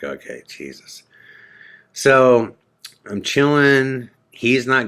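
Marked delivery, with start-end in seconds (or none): 0.0-1.0 exasperated mutter. Retold or imitated. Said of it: "I'm like".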